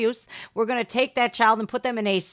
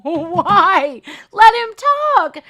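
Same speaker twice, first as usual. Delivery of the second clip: loud, high shout of protest; shouted demand; 7 semitones higher, 6 dB louder, much slower than usual. Real, not acted. Reactor shouting at the interviewer to let him talk, loud protest. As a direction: yell it at the screen.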